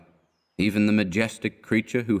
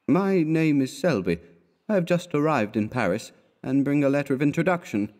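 higher-pitched, mildly nervous introduction; shy, nervous; 6 semitones higher than usual. A narrator's reading. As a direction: introduce yourself shyly, voice pitched up. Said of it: "shy and nervous".

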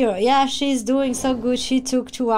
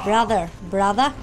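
usual speaking voice

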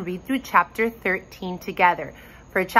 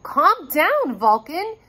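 high, urgent storybook call; mild yell; 7 semitones higher, slower than usual. A narrator's reading. Rory trying to settle an upset dragon, mild yell. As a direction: raise the pitch as if calling out urgently. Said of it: "Rory yelled".

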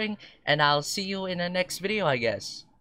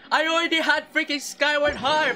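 frustrated rant; 10 semitones higher than usual. Real, not acted. Player ranting at the banner for another Skyward Harp, furious. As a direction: rage at the game, cracking into shouts. Ranting about unwanted weapon.

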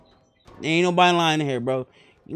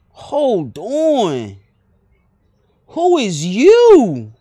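drawn-out, swooping words, getting much louder; exaggerated double take; 3 semitones higher, 8 dB louder, much slower than usual. Real, not acted. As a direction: stretch it out in loud, incredulous interest. Stops someone who catches his eye.

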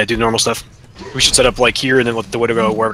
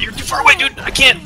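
shouting orders over battle; 13 semitones higher than usual. Real, not acted. Calling out in firefight.

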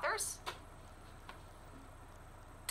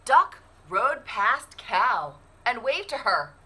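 put-on lively storybook narration for kids; animated picture-book read-aloud; reading to children; 6 semitones higher, 13 dB louder, much slower than usual. A narrator's reading. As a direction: read it like story time at the library.

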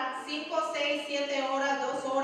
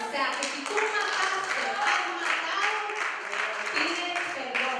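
louder, slower, pressing plea; forceful exhortation; 4 semitones higher, 4 dB louder, slower than usual. Real, not acted. Preacher urging the congregation to ask forgiveness, forcefully. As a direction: drive home the call to repent.

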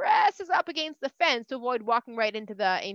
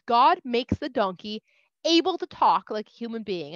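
ordinary delivery, nothing marked

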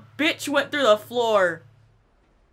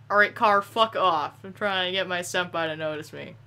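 normal talking voice

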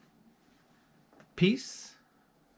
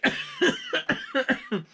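squealing laugh; 3 dB louder than usual. Real, not acted. Laughing at own impression.

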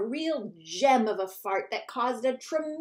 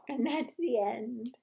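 plain reading voice

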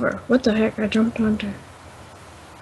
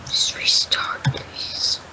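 soft pleading whisper; 7 dB quieter, much slower than usual. Real, not acted. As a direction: breathe the request, voiceless. Pleading whisper after being refused.

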